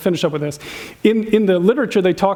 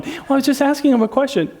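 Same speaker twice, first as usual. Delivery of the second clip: defensive excuse; 6 semitones higher than usual. Acted. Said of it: "No, you weren't".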